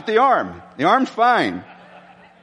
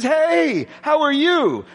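sunny hello, higher pitch; bright cheerful greeting; 8 semitones higher, slower than usual. Retold or imitated. Melissa greeting the pastor, cheery higher hello. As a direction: greet him like a hundred-million-dollar smile. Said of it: "She says".

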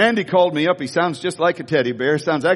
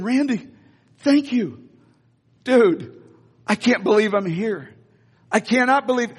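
excited gratitude; 4 semitones higher than usual. Retold or imitated. Thanking friend at game again.

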